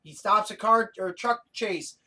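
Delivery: ordinary delivery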